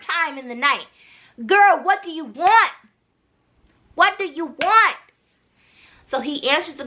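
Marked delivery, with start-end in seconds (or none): 1.5-5.0 higher-pitched, fed-up questioning; exasperated demand. Acted. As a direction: scold the caller in mock exasperation. Acted scolding of the repeat caller.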